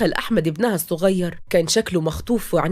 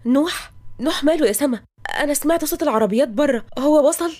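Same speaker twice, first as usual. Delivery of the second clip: higher, anxious questioning; worried; 7 semitones higher than usual. A narrator's reading. Bride asking her sister about the groom, worried. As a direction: ask with mounting worry. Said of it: "تقى بقلق".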